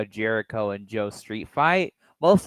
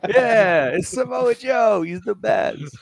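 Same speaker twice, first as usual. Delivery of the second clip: high, loud, drawn-out pretend cheer; mock fan cheering; 9 semitones higher, 7 dB louder, slower than usual. Co-host joining the yelling-fan bit, mock cheering. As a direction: whoop it like a gushing superfan.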